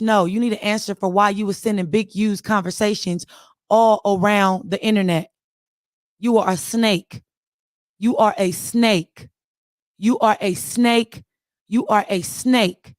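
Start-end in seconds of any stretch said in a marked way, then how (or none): 6.2-12.9 slow, measured condemnation; drawn-out, emphatic repeated charge; deliberate accusation. Real, not acted. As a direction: spell out the accusation slowly, pausing between repeats.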